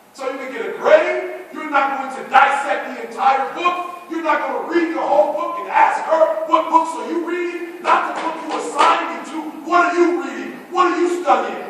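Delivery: loud, high preaching to the crowd; fiery preaching. Acted. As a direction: hammer the point home to the students. Preaching at full volume.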